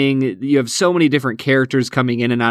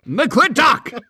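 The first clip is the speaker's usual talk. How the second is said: high, playful mock yell of a name; exclamation-point delivery; 12 semitones higher than usual. Shown as a demonstration. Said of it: "You gotta say it like that".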